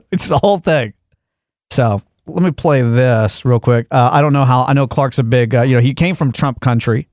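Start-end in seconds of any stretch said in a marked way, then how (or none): none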